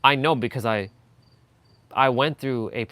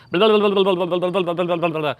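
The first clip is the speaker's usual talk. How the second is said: mock rapid babble; 5 semitones higher, 5 dB louder, faster than usual. Shown as a demonstration. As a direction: rattle off blah blah fast and loud. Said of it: "talking at a million miles a second".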